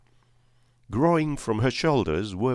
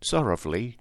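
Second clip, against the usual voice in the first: ordinary delivery, nothing marked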